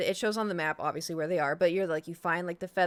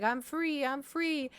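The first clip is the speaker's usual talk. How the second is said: high happy chirp; 7 semitones higher, much slower than usual. Retold or imitated. Freed bird voiced high.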